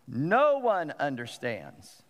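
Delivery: "No one understands" drawn out slowly, weight on NO; emphatic. Retold or imitated. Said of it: "now he's emphasizing it".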